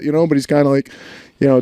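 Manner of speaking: normal talking voice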